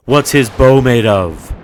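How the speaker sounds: sudden loud, forceful question; thunderous demand. A narrator's reading. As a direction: strike like lightning and thunder. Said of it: "her voice was swift as lightning and strong as thunder".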